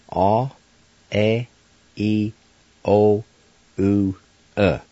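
"aw, eh, ee, o, oo, uh", each vowel held alone; Cherokee vowel sounds. Shown as a demonstration. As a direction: sound out "aw, eh, ee, o, oo, uh" one by one, with pauses. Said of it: "And the sounds are".

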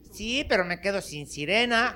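put-on high-pitched child's retort; little boy. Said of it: "Y le dice el chavito".

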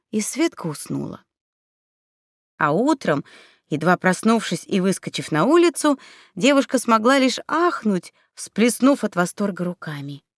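plain reading voice

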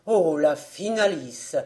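exclamation bien plus forte; cri désolé du père; le père s'écrie. A narrator's reading. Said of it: "s'écrie". (le père s'écrie fort)

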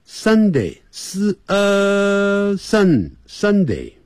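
Sunday built slowly from s- and sun; teacher rebuilding Sunday; slow correct build-up. Shown as a demonstration. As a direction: assemble Sunday slowly, sound by sound. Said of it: "这样念".

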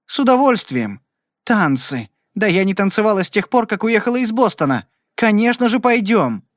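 high, eager, happy chatter; girl thrilled to go dancing, high and eager; joyful excitement. A narrator's reading. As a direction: bubble over with delight at the invitation. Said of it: "радостно сверкая глазами, воскликнула".

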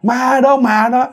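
high, wailing cries of ghost terror; acted terrified wail, held high throughout; panicked crying out. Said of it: "Ông la lên".

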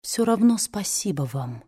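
drowsy murmur; sleepy mumble. A narrator's reading. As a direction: murmur it half asleep. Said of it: "пробормотала она, засыпая".

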